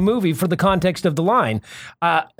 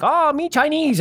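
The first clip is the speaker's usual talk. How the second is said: mock Chinese accent; 9 semitones higher, slower than usual. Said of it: "he's doing this, like, horrible".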